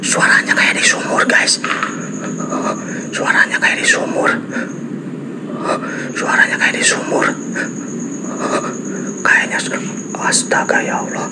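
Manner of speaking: hushed, voiceless whispering; fearful whisper. Real, not acted. Ghost hunter tells viewers about the well, whispering. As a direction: whisper as if something might hear you. Frightened, listening near the well.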